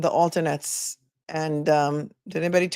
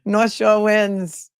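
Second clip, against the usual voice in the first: broad Massachusetts accent, drawn out and higher; 4 semitones higher, 4 dB louder, much slower than usual. Shown as a demonstration. Repeating a viewer's verdict after laughing, in a Massachusetts accent. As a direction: North Shore wins in slow, hammed-up Massachusetts vowels. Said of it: "with the Massachusetts accent".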